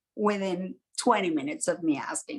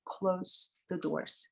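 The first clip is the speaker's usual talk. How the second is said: quiet aside; 9 dB quieter, much slower than usual. Real, not acted. Aside while setting up.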